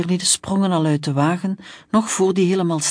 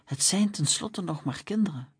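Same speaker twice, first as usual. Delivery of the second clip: much softer, reassuring; calming; 8 dB quieter than usual. Acted. Policeman calming his colleague about the children, soft. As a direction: soothe him down.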